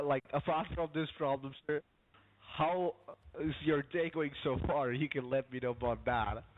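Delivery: laughing while changing the subject; giggly, trying to stay professional. Real, not acted. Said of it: "you're laughing".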